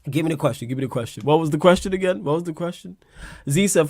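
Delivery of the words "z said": normal talking voice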